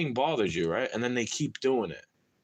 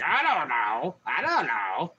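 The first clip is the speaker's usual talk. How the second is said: shrugging; slower than usual. Retold or imitated. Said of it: "You shrug your shoulders like".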